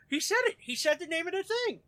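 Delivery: high, loud excited squeal; excited fan voice. Retold or imitated. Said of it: "You go".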